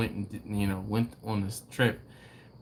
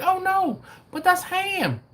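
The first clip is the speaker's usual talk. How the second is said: very high, louder mimicked waitress; dead-serious waitress; 4 dB louder, slower than usual. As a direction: mimic her earnest correction way up high. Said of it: "she was so dead serious".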